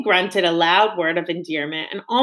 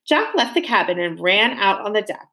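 usual speaking voice

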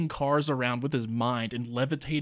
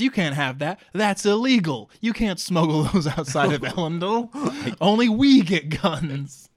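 smug mock scolding; 3 semitones higher, 5 dB louder than usual. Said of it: "Marasi says".

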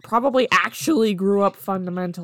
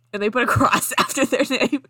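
laughing through the words, louder; 5 semitones higher, 6 dB louder than usual. Real, not acted. Reader telling a joke about a cross, real laughing. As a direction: burst out laughing as the line lands.

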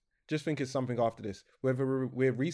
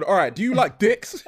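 loud, higher mock host opening; mock episode intro; 6 semitones higher, 10 dB louder than usual. Co-host jokingly opening the episode, loud mock intro. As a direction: blurt it loudly as a joke opener.

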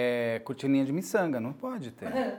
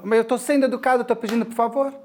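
higher, insistent mock indignation; mock aggressive tone; 3 semitones higher than usual. Imitating people who use please as a weapon, aggressively. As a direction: protest pointedly, pitch up to the end. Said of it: "numa entonação de agressão".

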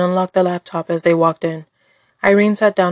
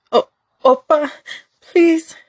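high, trembling plea to her abuser; desperate pleading; 10 semitones higher, slower than usual. Acted. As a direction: beg him, terrified and high.

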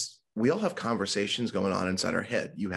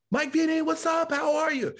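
much higher, bubbly carefree voice; chirpy friend; 4 dB louder than usual. Retold or imitated. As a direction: mimic her oblivious cheery greeting. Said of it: "She was like".

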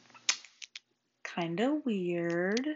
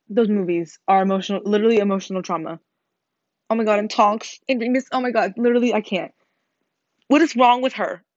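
loud; 8 dB louder than usual. Real, not acted. Loud rant about movie deaths.

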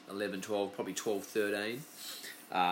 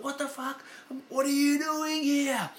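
high, slow, astonished outcry; stunned mate; 5 dB louder, slower than usual. Retold or imitated. Best mate equally shocked, high slow disbelief. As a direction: play the friend just as baffled. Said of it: "And he's like".

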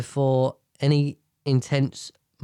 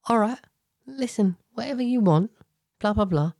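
non-defensive; 6 semitones higher than usual. Shown as a demonstration. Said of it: "Don't be all, like, defensive. Just go".

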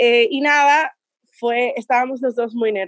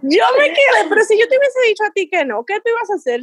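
stunned disbelief; 10 semitones higher, faster than usual. Retold or imitated. Shocked he bought the flight.

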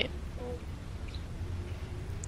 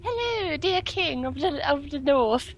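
put-on posh accent; 6 semitones higher, 4 dB quieter, slower than usual. Greeting king in posh voice.

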